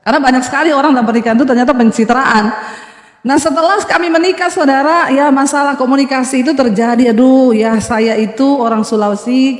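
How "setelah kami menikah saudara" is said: ordinary delivery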